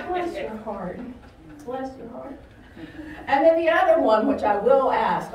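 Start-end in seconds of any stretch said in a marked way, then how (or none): 0.1-1.0 pitying, falling. Shown as a demonstration. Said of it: "Most of the time when I say". 1.6-2.4 softer, rising. Shown as a demonstration. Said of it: "Most of the time when I say".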